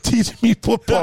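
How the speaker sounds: high, slow gushing plea; excited fan voice. Retold or imitated. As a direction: beg like a starstruck fan. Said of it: "He was like".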